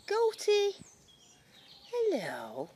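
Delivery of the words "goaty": calls the goat in a high, sing-song voice; high cooing call. Real, not acted. Calling to a baby goat, a high delighted coo. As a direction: coo it like greeting a pet.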